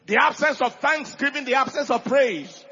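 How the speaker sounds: loud, emphatic sermon delivery; preacher exhorting the church; preaching at full force. Acted. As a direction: drive each line home to the congregation.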